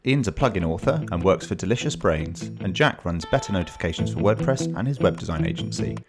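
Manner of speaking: upbeat announcer intro read; bright show opener over music; podcast intro. Acted.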